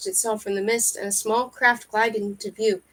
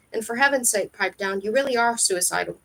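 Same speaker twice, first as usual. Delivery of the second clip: ordinary delivery, nothing marked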